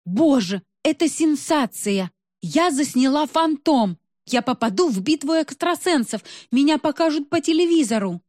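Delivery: high-pitched, excited exclamations; thrilled gushing. A narrator's reading. Girl thrilled at filming a phantom, excited high gushing. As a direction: gush as if you've just made a sensational discovery.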